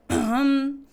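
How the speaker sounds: loud demonstrative throat-clear. Shown as a demonstration. Teacher shows students how to interrupt, clearing throat. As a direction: clear the throat pointedly to cut in. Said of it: "could be clearing your throat, like".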